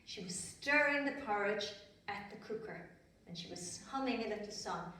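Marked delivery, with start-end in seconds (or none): none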